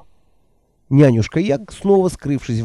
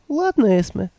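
put-on higher, timid old woman voice; meek old woman; 8 semitones higher than usual. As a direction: answer meekly, eager to please. Nanny voiced by narrator.

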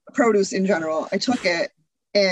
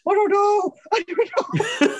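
alternating two voices; 14 semitones higher than usual. Retold or imitated. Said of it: "I'm like".

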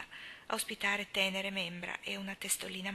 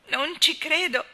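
loud, high, slow cry of anguish; 8 semitones higher, 11 dB louder, slower than usual. A narrator's reading. Anna refusing Marilla's comfort, anguished loud cry. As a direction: wrench the words out. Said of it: "esclamò Anna con angoscia".

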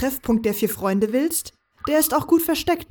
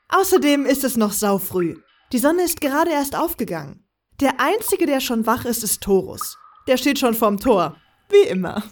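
plain reading voice

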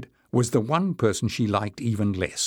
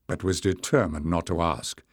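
ordinary delivery

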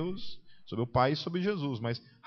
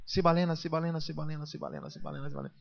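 one word chanted over and over, fading softer; imitated tongues-speaker; 4 semitones higher, 3 dB quieter, faster than usual. Retelling a worshipper praying in tongues, mock repetitive chant. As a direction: mimic someone looping a single word in rapid repetition. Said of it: "uma pessoa falava assim".

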